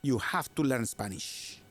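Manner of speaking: a hammed-up, badly faked Spanish accent. Teasing Jen about learning Spanish, bad Spanish accent. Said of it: "the worst Spanish accent I've ever done".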